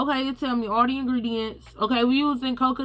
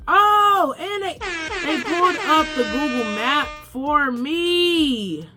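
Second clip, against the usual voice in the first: high, delighted exclaim; excited surprise; 6 semitones higher, slower than usual. Real, not acted. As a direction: gasp of happy surprise. Delighted the AI shows maps again.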